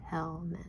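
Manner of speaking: "Helmet" in two hushed beats; tapped-out syllables. Shown as a demonstration. Teacher repeating the tapped "Helmet", softly, syllable by syllable. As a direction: softly separate hel and met in "Helmet".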